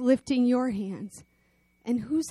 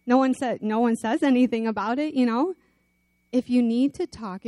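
ordinary talk

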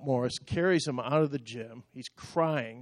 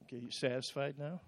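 very soft, quick muttered question; sheepish aside; 14 dB quieter, faster than usual. Real, not acted. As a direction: concede it under your breath. Wry confession closing the story.

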